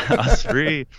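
laughing. Real, not acted. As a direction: laugh through the words with relief. Laughing with relief at freedom.

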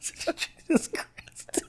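whisper. Real, not acted. Laughing aside.

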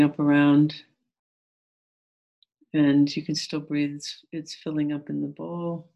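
calm, gentle meditation guide. Acted.

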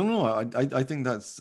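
ordinary talk, nothing marked

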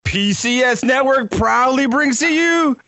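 booming high ringmaster announcer. Acted. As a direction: hype the crowd like a circus ringmaster. Podcast intro announcer hype.

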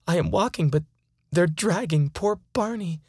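usual speaking voice

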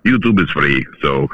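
put-on Russian accent. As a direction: play it as a Russian speaker. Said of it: "I didn't know you could do Russian accents".

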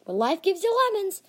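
high, loud gleeful yell; screamed. Acted. Brother bursting in on sibling at breakfast, screamed. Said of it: "laughing hysterically and screamed".

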